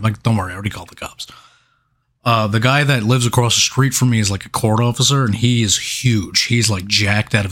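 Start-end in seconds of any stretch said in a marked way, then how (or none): 0.2-1.3 rushed, hushed whisper; a quick whispered aside to the worried neighbors; fast whisper. Retold or imitated. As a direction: hurry the words out under your breath. Reassuring neighbors, retold.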